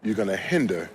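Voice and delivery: low, soft and deliberate; hushed, weighty sermon takeaway; solemn preaching point. Acted. Slow solemn closing point.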